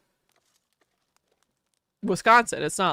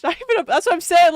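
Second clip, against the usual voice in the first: louder, higher, laughing through it; cracking up in disbelief; 8 semitones higher, 6 dB louder, faster than usual. Real, not acted. Laughing disbelief at streamer's cabbage.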